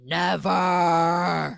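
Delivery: loud, drawn-out theatrical defiance; hammed-up heroic refusal; mock defiant cry. She turns back and refuses him, loud mock defiance. She defies the ultimatum, retold.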